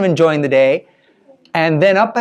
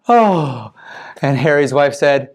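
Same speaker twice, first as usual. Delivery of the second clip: ordinary delivery, nothing marked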